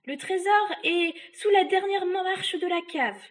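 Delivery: high, loud desperate shout; witch crying out. Acted. Trapped witch to the boy, high shouted confession. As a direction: cry out the secret to save yourself. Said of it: "La sorcière cria".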